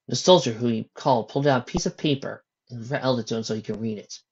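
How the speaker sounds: ordinary delivery, nothing marked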